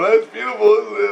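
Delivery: high-pitched mock weeping; tearful imitation. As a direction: imitate someone moved to tears, voice pushed up. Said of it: "He's crying".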